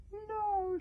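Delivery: high-pitched little dragon cry; shrieked. A narrator's reading. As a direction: squeal it in alarm. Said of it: "shrieked Dragon Toothless in his thoughts".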